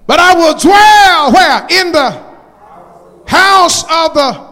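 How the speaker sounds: high, loud psalm climax with congregational asides; full-force preaching. Acted. Preacher telling where he will dwell, full-force preaching. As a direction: build to a ringing cry on the scripture.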